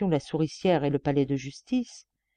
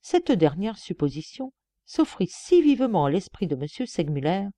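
usual speaking voice

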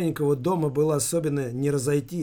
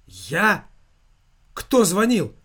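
startled, higher and louder burst; blurted question; 5 semitones higher, 5 dB louder, slower than usual. A narrator's reading. Son reacts to news of a call from work, blurting. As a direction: blurt it out in shock. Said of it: "выпалил он".

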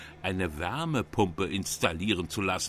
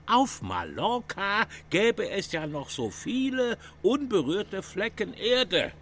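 lightly mocking the daughter in a higher voice; a hint of the naive daughter, pitched up; imitating his daughter; 10 semitones higher than usual. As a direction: parrot her enthusiasm, a bit higher. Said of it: "sagt meine Tochter".

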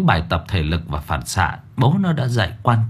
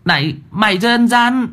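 put-on high, tearful child's protest; a little boy crying foul; whimpering boy; 12 semitones higher than usual. A narrator's reading. Boy protests to the demon, whimpering. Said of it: "Nó ôm miệng đứng dậy mếu máo".

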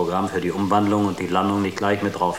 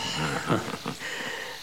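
soft airless chuckle; a breathy laugh under the breath; 5 semitones lower, 8 dB quieter than usual. Acted. Crewmate joking about paradise, soft airless chuckle.

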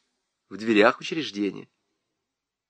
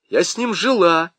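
higher-pitched cheeky retort; defiant; 6 semitones higher, 4 dB louder than usual. A narrator's reading. Nyura answers the questioning lieutenant, mildly defiant. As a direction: answer back with a touch of defiance. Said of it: "дерзко сказала Нюра".

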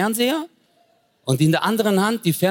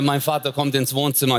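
ordinary delivery

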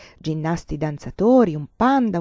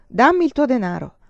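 threatening; 6 semitones higher, 6 dB louder than usual. A narrator's reading. Swordsman robs the farmer.

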